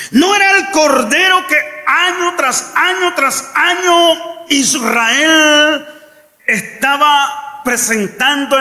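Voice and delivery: rising sermon fervour, near shouting; preaching at full force. Acted. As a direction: preach it out to the congregation.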